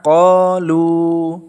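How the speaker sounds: qalu, very slow, the final lu held for two beats; qalu with long lu. Shown as a demonstration. Teacher modelling the correct long qalu, very slowly. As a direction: draw out the lu of qalu. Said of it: "dibaca panjang dua harokat".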